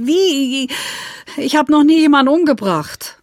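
pleading she can't kill anyone; alarmed protest over killing a character; upset outburst. Acted. Distressed author protesting.

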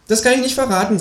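high-pitched kangaroo voice; secretive kangaroo. Acted. Kangaroo keeps the conspiracy from the narrator, high creature voice. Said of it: "sagt das Känguru".

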